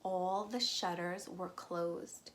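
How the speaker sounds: plain reading voice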